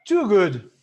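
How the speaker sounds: mock-gushing "Too good."; exaggerated "too good". Shown as a demonstration. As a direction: push "Too good." over the top, ironically. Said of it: "Esse too sobra, é um exagero".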